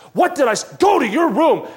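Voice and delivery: loud, high angry-dad outburst; dad's angry yell. Acted. Dad sending child to room, angry shout. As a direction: act out dad's temper at fever pitch. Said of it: "dad says ... fever pitch".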